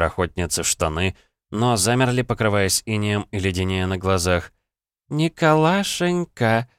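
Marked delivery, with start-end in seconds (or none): 5.1-6.7 high, slow, sugary chirp; sweet chirping. A narrator's reading. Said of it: "с милой улыбочкой прощебетала".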